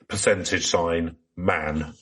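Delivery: ordinary delivery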